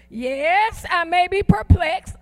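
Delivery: slow, loud declaration with attitude; emphatic declaration. Shown as a demonstration. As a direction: draw out YES and declare PERPLEXED forcefully. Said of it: "I'm declaring it".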